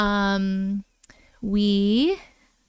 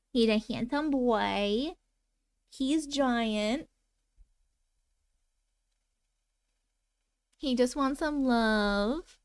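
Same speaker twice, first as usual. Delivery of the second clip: softer, tender pet talk to the cat; soft cooing to the cat; 7 dB quieter than usual. Real, not acted. Showing her cat Odin to viewers, soft tender cooing.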